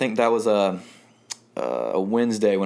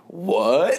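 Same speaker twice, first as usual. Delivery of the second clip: surprised; 10 semitones higher, 3 dB louder than usual. Retold or imitated. Sudden email from USF.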